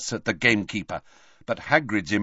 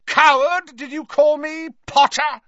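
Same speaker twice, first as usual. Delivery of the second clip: outraged shout, high and loud; Snape; 13 semitones higher, 6 dB louder than usual. A narrator's reading. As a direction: throw the insult back at full voice. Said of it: "shouted Snape".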